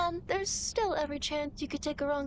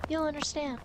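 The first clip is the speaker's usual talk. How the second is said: ordinary talk, nothing marked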